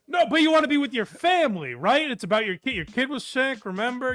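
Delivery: mock rant. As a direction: needle him with loud fake concern. Mocking rant at the comedian.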